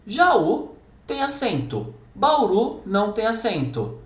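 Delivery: each word set apart, Jaú stressed and slow; slow exam dictation. Shown as a demonstration. Teacher reads the exam question to students, slow and stressed.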